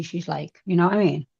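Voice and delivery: ordinary talk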